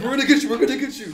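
high, loud and laughing; mock urgent reassurance. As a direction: call it out urgently, played for laughs. Re-enacting the frantic drive to help.

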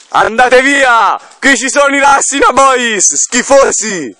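high, slow shout to scare people off. Pretend. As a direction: bellow to chase them from the woods. Scaccia i rivali dal bosco. (urlo acuto e lento)

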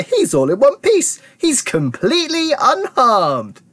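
high, delighted announcement; jubilant cry. A narrator's reading. Wonka celebrating the boy's safety, crying out. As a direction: proclaim it high, stretching the last words. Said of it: "cried Mister Wonka".

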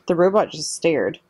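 ordinary delivery, nothing marked

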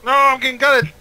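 panicked yell. Real, not acted. Under fire in the game.

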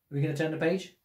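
ordinary delivery, nothing marked

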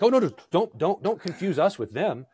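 high, flustered mock protest; mock panic. Imagined panicky Paul objecting to being confused with them, mock panic. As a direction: play at panicking, pitch jumping up. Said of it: "Paul's response is not panic".

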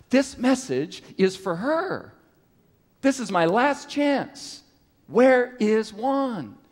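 rising, slowing, anxious thought; nervous inner voice. Retold or imitated. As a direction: panic building as the translator is missing. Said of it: "I began to get nervous".

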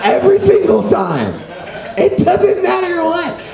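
hammed-up tearful whine; mock crying. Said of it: "Whenever I cry, I always say".

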